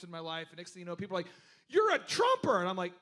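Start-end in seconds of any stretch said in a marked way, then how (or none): 1.7-2.6 high, loud accusing jeer; mocking accuser. People accusing him of being a Trumper, high and loud.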